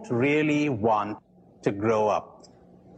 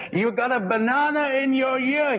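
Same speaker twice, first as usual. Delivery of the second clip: pitched much higher, calling to be heard; 11 semitones higher than usual. Retold or imitated. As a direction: say it again for someone who missed it. Repeating to a man who can't hear.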